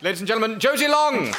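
high, building shout of the act's name; big live-show introduction, rising to the name; announcer's introduction. Real, not acted. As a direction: announce the act to a cheering crowd. Host introducing act to audience.

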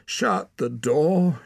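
loud, high, slow protest. A narrator's reading. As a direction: call it out in protest, drawing out the words. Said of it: "she protested".

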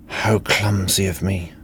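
lightly husky, breathy seduction; a touch of breathy femme fatale; husky breathing. A narrator's reading. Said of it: "she breathed huskily".

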